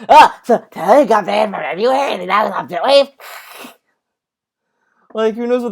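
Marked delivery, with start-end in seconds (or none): 0.1-3.1 hammed-up put-on mocking voice; Phil. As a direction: imitate an incredulous streamer, garbled and overdone. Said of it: "He's like".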